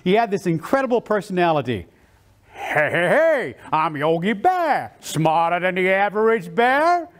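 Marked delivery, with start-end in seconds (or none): 2.5-7.1 bigger-than-life cartoon bear with drawn-out words; Yogi Bear. Speaker shows off his favourite TV bear, big cartoon bear voice. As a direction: play a larger-than-life bear, stretching the greeting. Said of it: "Yogi Bear was bigger than life".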